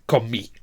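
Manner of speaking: Comí said "ko-MEE", falling; final-syllable stress. Shown as a demonstration. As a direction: land on the last syllable of Comí. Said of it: "we have the stress on the final syllable".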